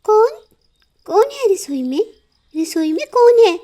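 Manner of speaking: startled mother bird; alarmed call. Acted. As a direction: call out into the dark kitchen. Bird woken by noise.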